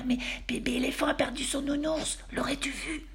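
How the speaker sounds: high, soft whisper. A narrator's reading. Elmer asks Tiger quietly by the sleeping twins, whisper.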